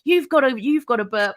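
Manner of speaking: loud, high nagging friend; mock bossy friend yelling; mock bossy demand. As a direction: push her to burp, loudly. Friend demanding she burp.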